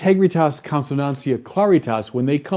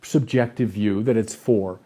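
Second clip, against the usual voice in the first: much softer, lower, sugary mock patience; mock gentle indulgence; 4 semitones lower, 6 dB quieter than usual. A parent to little Zelly about two plus two, in a mock soft indulgent tone. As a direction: coo it like an overindulgent parent. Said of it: "the last thing you would ever do is say".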